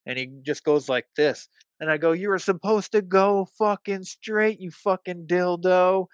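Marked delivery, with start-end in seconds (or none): 2.1-6.1 high, raised indignant yell; retold angry yell. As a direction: tell off a driver from your window, pitch up. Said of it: "because I yelled at him".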